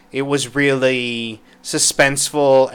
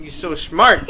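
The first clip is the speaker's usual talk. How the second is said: ordinary delivery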